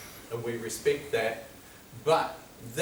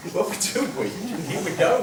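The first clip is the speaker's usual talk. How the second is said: laughing; 8 semitones higher, 6 dB louder than usual. Real, not acted. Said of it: "we're laughing about this stuff".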